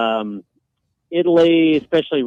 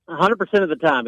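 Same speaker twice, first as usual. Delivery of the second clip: usual speaking voice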